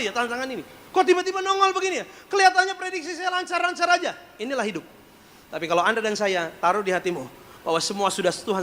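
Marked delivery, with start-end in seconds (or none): 0.9-4.1 high exasperated exclamation; heran gemas. Real, not acted. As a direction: push it high and keep it there. Preacher exclaiming about unexpected challenges. (seruan heran bernada tinggi)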